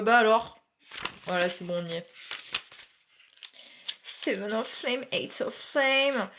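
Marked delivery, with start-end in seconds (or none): 4.0-6.3 slow card reading in a French-accented English; slow, careful English with a French accent. Shown as a demonstration. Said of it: "j'ai envie de me frapper mon accent".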